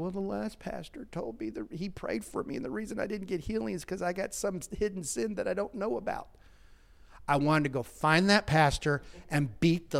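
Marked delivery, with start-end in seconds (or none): 0.0-6.3 lightly voiced, higher frail old lady; hurt old woman. Pastor retells woman in hospital, higher and frail. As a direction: speak as the sick woman, pleading. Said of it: "She said".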